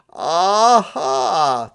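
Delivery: loud, high Aha of discovery; surprised aha. A narrator's reading. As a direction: catch on with a bright Aha. Santa realizes the trick.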